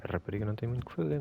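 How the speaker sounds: ordinary talk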